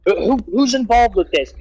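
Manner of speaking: high, urgent mock questioning; retold demanding interrogation. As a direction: imitate police demanding a culprit, pitch jumping up. Acts out MPs demanding answers.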